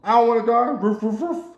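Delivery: high-pitched talking-dog voice with woofs; pleading dog. Acted. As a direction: yelp it like a dog begging for its life. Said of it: "the dog looked like".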